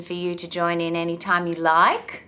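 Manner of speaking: "like" sweeping sharply upward, inviting a reply; rising end intonation. Shown as a demonstration. Inviting listeners to join in, upward inflection on "like". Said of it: "go up at the end of the sentence".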